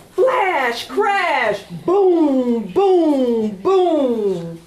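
dramatic thunder refrain; thunder booms. Acted. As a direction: shout the storm noises.

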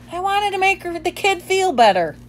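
high, sheepish little dog; small dog voice. A narrator's reading. Bob the dog answering Ivan, high and sheepish. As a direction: plead it up high, apologetic.

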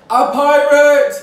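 high, loud, drawn-out announcement; theatrical slow shout; excited reveal shout. Pretend. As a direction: belt it out as a big reveal. Big reveal of the pirate.

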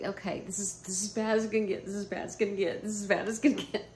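fast, repeated self-talk mantra; anxious mantra. Retold or imitated. Talking herself down in the airport, fast anxious mantra. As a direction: rattle it off to calm yourself. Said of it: "I was like".

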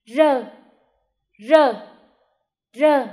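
put-on teacher calling out rờ for children to repeat; loud model sound. Shown as a demonstration. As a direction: model rờ firmly so the whole class hears. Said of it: "Các em nghe cô phát âm".

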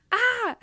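a sudden high squeal of joy; delighted squeal. Real, not acted. Podcast host squeals at a listener's kind review, delighted. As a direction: squeal like the review just made your day.

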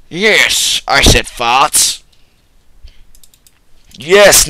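loud, hammed-up fake British yes; a clumsy mock British confirmation; bad British accent. Vlogger owning the joke, in a loud fake British accent. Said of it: "very bad British accent".

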